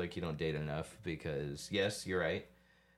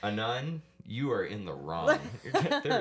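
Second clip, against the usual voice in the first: laughing mock verdict; 5 semitones higher, 6 dB louder than usual. Real, not acted. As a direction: scold through laughter.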